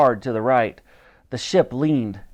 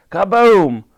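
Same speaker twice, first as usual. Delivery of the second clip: loud, high kaboom; booming explosion; 7 semitones higher, 8 dB louder than usual. A narrator's reading. The next barrel blows up, loud booming. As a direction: blast the word out.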